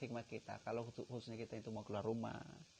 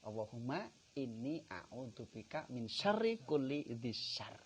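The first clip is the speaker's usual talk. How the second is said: slow, louder Arabic supplication; prayer recitation; 5 dB louder, slower than usual. Acted. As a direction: intone the prayer slowly.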